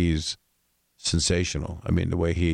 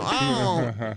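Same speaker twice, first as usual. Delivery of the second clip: cartoon yelp; 11 semitones higher than usual. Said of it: "and go".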